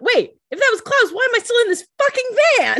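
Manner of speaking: high, loud, fast indignant outburst; outraged yell. Retold or imitated. Rebecca left tied in the van, outraged yell. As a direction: rant at being left behind, voice shooting up.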